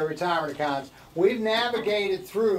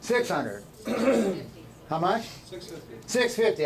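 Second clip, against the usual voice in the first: ordinary talk, nothing marked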